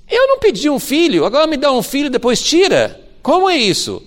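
high, loud reproach of a grieving mother; quarrelling with him. Retold or imitated. Grieving mother confronts the prophet, loud and accusing. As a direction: throw the complaint back at the prophet. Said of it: "ela briga com ele".

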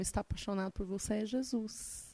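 soft, hushed and quick, then gentle; tender reveal. Retold or imitated. Telling the man that his secret admirer is Jesus, tender. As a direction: reveal it softly like a secret gift.

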